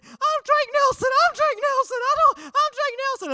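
put-on high, far, frantic child's voice calling out his name; a small boy running and shouting his own name; panicked little kid. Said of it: "running down the hallway saying".

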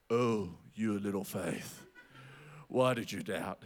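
very low, slow, solemn voice; deep, slow voice of a disappointed master; gentle divine rebuke. Acted. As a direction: drop deep and slow, chiding softly. Voices Jesus gently chiding Peter.

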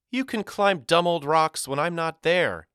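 put-on high, huffy girl's comeback; countering. A narrator's reading. Girl arguing against rock climbing, countering in a huff. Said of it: "she countered".

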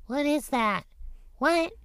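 put-on high, squeaky child voice asking what it is; curious little kid.

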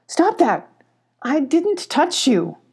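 high, indignant squabble; quarrelling. A narrator's reading. Two guards start quarrelling.

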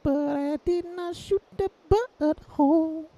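very high, slow cartoon voice; high silly voice. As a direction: squeak it out slowly. High goofy voice bit.

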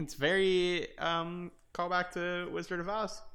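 ordinary talk, nothing marked

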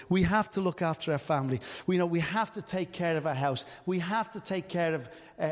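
ordinary talk, nothing marked

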